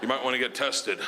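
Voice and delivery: low, hesitant deadpan; awkward bad news. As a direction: break it reluctantly, with long pauses. Producer awkwardly breaking bad news.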